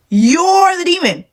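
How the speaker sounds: high, slow, drawn-out taunt; mocking retort. Woman throws the demon label back at her ex, high mocking drawl.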